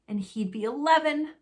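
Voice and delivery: ordinary delivery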